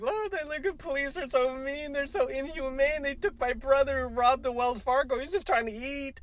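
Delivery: high, whiny mock sister; hammed-up pleading sister. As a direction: whine for the robber brother. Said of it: "it's like your sister or something".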